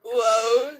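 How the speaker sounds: ordinary talk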